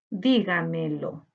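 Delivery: teacher splitting "Dígamelo" into four syllables. Shown as a demonstration. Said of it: "debemos separar por sílaba". ("Dígamelo" más grave, sílaba por sílaba)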